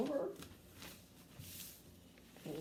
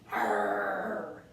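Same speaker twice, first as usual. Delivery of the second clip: a louder growled arr; pirate arr; 5 dB louder than usual. Shown as a demonstration. Showing kids how pirates talk, a mild growled arr. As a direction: give it a hearty pirate arr. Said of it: "learn to talk like a pirate".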